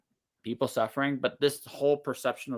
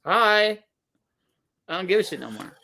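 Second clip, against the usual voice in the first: mock chirpy hi; 7 semitones higher, 4 dB louder than usual. Mocking the culture's numb reply.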